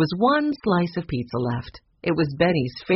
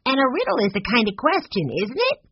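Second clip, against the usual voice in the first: put-on high-pitched child voice, rising sharply; little boy asking; 9 semitones higher, faster than usual. A narrator's reading. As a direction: ask like a curious kid. Narrator voices young Benny asking.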